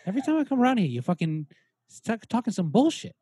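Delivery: mock-offended Boston celebrity; Mark Wahlberg. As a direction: grumble in a hammed-up Boston accent. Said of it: "It's me, it's fucking Mark Wahlberg".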